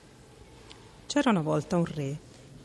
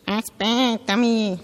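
slow, louder frog call from behind; frog shouting; 5 semitones higher, 5 dB louder, much slower than usual. A narrator's reading. Frog calls after the running princess, shouting. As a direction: call after her, stretched out. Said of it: "Il ranocchio le gridava dietro".

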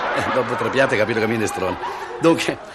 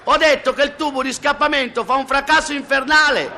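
high, sustained yell; comic straining shout on one high note; acted shout. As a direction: holler it over a roaring exhaust. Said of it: "urla lui".

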